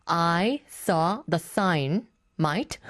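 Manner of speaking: very slow repeat, word by word. Retold or imitated. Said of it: "to repeat what [name] said".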